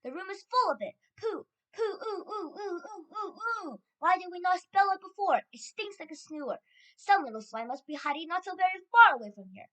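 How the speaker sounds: high-pitched, agitated cries; disgusted outcry. A narrator's reading. Witches reacting to the stink, crying out. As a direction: cry out in high-pitched revulsion. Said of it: "they shouted".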